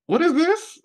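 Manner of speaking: high-pitched surprised question; retold surprise. Seeing the crock pots of food, high surprised question. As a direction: ask it high, amazed at the sight.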